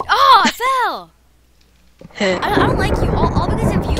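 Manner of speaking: loud, high cry of dismay; upset outcry. Real, not acted. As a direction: wail accusingly at your teammate. Blames other player for fall.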